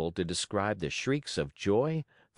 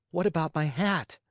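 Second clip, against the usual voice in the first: put-on boy voice; 7 semitones higher than usual.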